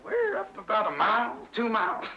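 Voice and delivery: joke-teller's lightly voiced eagle. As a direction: toss it off like the big bird. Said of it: "And the eagle says".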